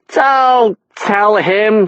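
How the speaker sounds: high, slow whiny plea; whining. A narrator's reading. Mother whines at husband not to tell son. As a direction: drag it out in a whine. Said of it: "she whined".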